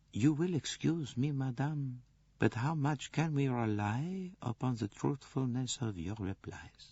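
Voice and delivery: softly probing, fading at the end. A narrator's reading. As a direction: ask the delicate question very softly. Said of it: "Poirot said the words very softly".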